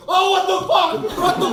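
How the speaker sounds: very high, loud shriek of horror; sudden high-pitched freak-out; shocked scream. Acted. Errand guy walks in on the scene.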